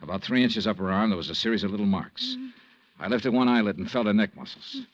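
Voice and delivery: low, even first-person narration; story narration. A narrator's reading. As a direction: tell it like a hardboiled private eye.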